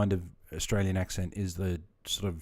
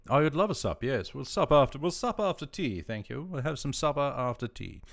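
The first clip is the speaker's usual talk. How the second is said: higher, hammed-up polite acceptance; playful mock-polite voice; 6 semitones higher than usual. Streamer joking about sup meaning supper, playful mock-polite.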